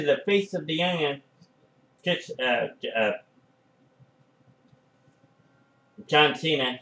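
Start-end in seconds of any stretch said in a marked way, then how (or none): 2.0-6.8 talking through hiccups; hiccuping between words. Real, not acted. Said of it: "think I got the hiccups".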